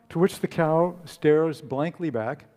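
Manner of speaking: usual speaking voice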